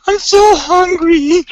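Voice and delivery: high, slow whiny hiss of hunger; hissing hungry voice. Retold or imitated. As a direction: stretch it out like a starving hiss. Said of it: "literally almost like a hiss".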